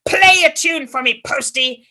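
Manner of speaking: loud, gleeful demands to party; the old man demanding fun from the postie, loud and gleeful; exuberant shout. Acted. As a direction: shout it with wild glee. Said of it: "full of life".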